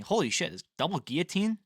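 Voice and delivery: normal talking voice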